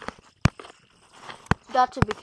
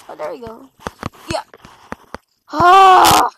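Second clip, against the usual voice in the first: ordinary talk, nothing marked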